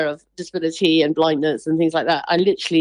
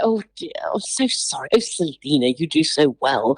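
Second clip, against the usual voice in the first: whispered, lower patronising sympathy; head-tilting pity; 5 semitones lower than usual. Retold or imitated. Imitating strangers who pity the blind woman. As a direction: coo it like a pitying stranger. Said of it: "the sort of tilting of the head".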